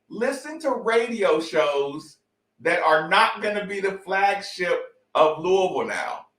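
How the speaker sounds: usual speaking voice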